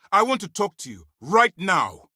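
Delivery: high, loud summons; angry demand. Acted. Officer confronting a turncoat agent, angry demand. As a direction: order them over, hitting the last words hard.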